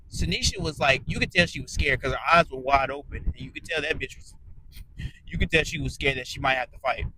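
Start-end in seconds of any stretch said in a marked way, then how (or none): none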